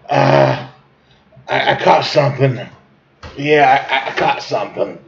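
halting sick-day phone call; hammed-up poorly voice with feeble pauses; faking sick. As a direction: cough and hesitate like calling in sick. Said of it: "So it kinda went like this".

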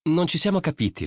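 voce di ragazza molto più alta; ragazzina seccata. A narrator's reading. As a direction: ribatti alta e spazientita. Nina punzecchia Lori. (Nina a Lori, voce alta e seccata)

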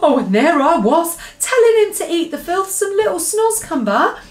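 high, loud, appalled exclamation; horrified cry. A narrator's reading. BFG realises Sophie was inside, horrified. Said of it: "said the B F G cried".

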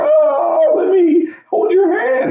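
high, loud whiny plea; a mocking falsetto beg for comfort; mock pleading sinner. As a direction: ham up a needy voice.

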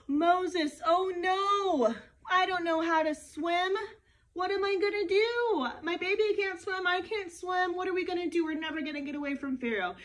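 frightened crowd pleading with Moses; worried people. Acted. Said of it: "they say".

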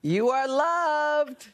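high, long drawn-out cheer; gushing call-out. Real, not acted. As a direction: stretch it out high and slow. Calling out to surprised guest.

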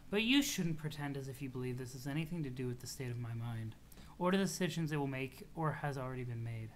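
understated, quietly formal refusal; lightly voiced deadpan character. Character brushes off a friend's plea, lightly deadpan.

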